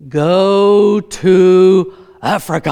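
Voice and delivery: loud, drawn-out mock voice of God; booming divine voice. Pretend God answering a prayer for direction, booming and slow. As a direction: boom it out very slowly like a command from heaven.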